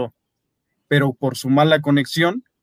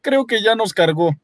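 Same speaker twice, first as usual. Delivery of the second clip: high worried voice; Diego, scared; 8 semitones higher than usual. A narrator's reading. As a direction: say it like you're done for. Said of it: "dijo Diego".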